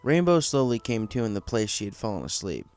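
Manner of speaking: plain reading voice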